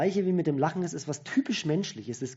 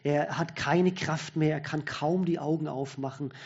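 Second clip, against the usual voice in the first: ordinary talk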